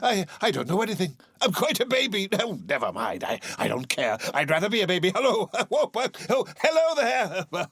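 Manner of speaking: put-on high, babbling childlike joy; giddy Scrooge. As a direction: babble happily like a baby.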